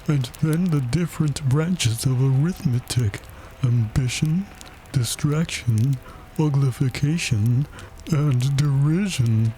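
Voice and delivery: high, solemn list with pauses; melancholy turtle voice; doleful Mock Turtle. A narrator's reading. Mock Turtle recites arithmetic branches.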